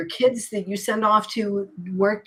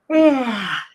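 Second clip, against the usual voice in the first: big audible yawning sigh; loud stretched-out yawn sound; fake yawn; 4 semitones higher, 7 dB louder than usual. Shown as a demonstration. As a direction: let out a loud demonstration yawn. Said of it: "a fake yawn like this".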